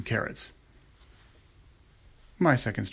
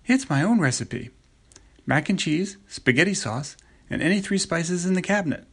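daughter voice; 7 semitones higher, 3 dB louder than usual. A narrator's reading. Sarah voiced in story.

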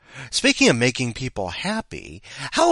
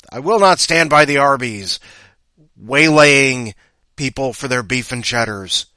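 loud rant; 2 semitones higher, 9 dB louder than usual. Acted. Ranting pledge continues.

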